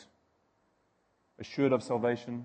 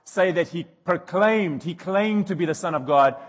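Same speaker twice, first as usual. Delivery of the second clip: raised, insistent objection; retold protest; 5 semitones higher, 8 dB louder than usual. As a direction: urge Pilate, louder and pushing.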